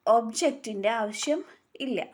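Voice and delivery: ordinary talk, nothing marked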